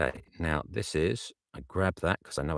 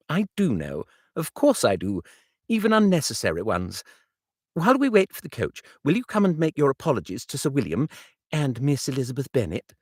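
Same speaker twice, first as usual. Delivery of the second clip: light, higher, hurrying friend; rattling it off playfully, higher; teasing Bingley, higher and quick; 10 semitones higher, 6 dB louder, faster than usual. Acted. Bingley teases Darcy and urges apologies to Sir William, higher.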